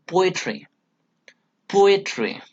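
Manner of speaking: "poetry" with PO high and leading, then falling; stress on first syllable. Shown as a demonstration. Teacher demonstrates a dactyl, stress on first syllable. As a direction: punch the PO in "poetry". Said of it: "is given importance here".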